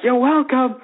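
high chanted reply, no real scream; mock crowd scream. Fans answering the chant leader, high mock-screamed reply. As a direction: imitate a crowd calling back in unison. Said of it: "And they're like".

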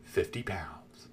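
slow, breathless whisper; whispered letdown. Retold or imitated. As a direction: drop to a whisper in disbelief. Anticlimax of the kid's prize.

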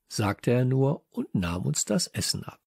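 usual speaking voice

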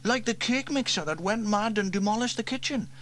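put-on high boy voice, unsure; young boy James shrugging it off. A narrator's reading. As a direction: voice the young brother. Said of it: "interrupted James".

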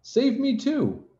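very high, drawn-out plea; crying out. A narrator's reading. Rabbit begging to be saved, high slow cry. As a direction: squeal it as a cry for help. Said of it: "he cried".

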